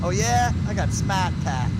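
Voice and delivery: light mock Boston boast, "Smart Pack" said slowly; smug Boston driver; Boston accent. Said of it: "native Bostonians".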